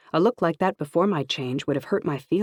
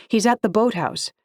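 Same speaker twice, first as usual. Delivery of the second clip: urgent; 7 semitones higher, 4 dB louder than usual. Acted. Urging men toward the ocean.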